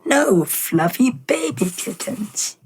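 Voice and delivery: a mad scientist cooing sweetly; evil scientist. Retold or imitated. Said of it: "And the scientist is like".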